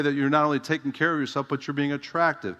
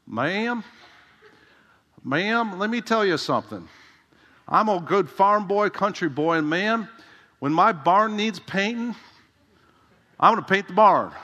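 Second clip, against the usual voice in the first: higher, slow southern country-preacher drawl; higher folksy southern drawl, leaning on each ma'am; southern drawl J. Vernon McGee; 7 semitones higher, 5 dB louder than usual. Retold or imitated. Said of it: "had this southern drawl".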